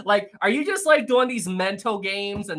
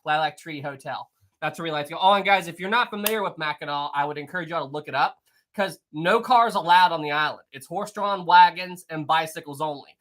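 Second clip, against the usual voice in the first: normal talking voice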